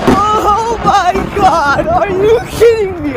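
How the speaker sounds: loud, laughing disbelief; shocked shout. Real, not acted. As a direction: yell it out amazed. Lightning hits right nearby.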